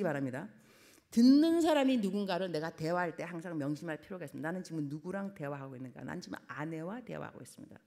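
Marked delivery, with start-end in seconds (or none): none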